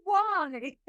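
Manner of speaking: high startled yelp of a question; alarmed why. Retold or imitated. Passenger hearing the return flight will be worse, startled and high. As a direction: shoot it up in disbelief. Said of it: "And I'm like".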